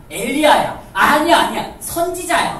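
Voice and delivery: loud, high insisting shout; excited insistence. Acted. A crowd member arguing who Jesus is, loud and insistent. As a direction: cut in loudly, sure of yourself.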